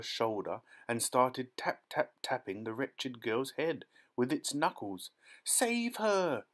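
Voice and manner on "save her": high panicked scream. A narrator's reading. Mother screaming as squirrels seize her daughter. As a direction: cry out for help in panic. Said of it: "screamed Missus Salt".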